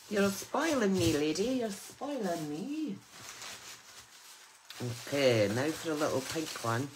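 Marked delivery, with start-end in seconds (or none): 0.1-1.4 delighted thanks. Real, not acted. Thanking friend for gifted crafts. 1.4-3.0 touched thanks. Real, not acted. Repeats thanks, drawn out.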